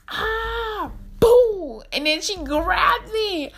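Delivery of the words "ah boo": hammed-up actor jumping out; clown jump scare. Actor banging on the glass room to scare them, mock boo. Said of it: "He was like".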